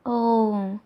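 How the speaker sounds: usual speaking voice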